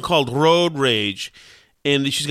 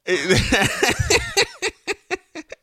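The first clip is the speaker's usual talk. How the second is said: high laugh. Real, not acted. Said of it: "We're shutting down the phone line".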